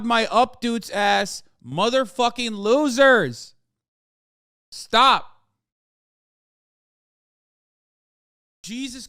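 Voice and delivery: rising from loud anger to screaming rage; escalating yell at annoying viewers; furious rant. Real, not acted. Ranting at chat, escalating.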